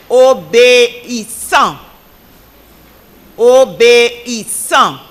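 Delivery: slow, syllable-by-syllable word call; careful dictation. Real, not acted. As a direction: sound out each syllable for the speller. Spelling bee word given out.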